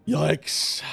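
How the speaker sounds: loud embarrassed yikes; cringing at yourself. Real, not acted. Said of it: "That was bad".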